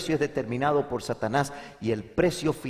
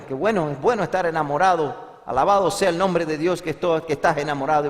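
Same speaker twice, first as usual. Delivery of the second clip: loud, higher, praising exclamation; delighted congratulation; 5 semitones higher, 7 dB louder than usual. Retold or imitated. Pastor congratulates a man in love, loudly delighted. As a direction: exclaim it with preacherly joy.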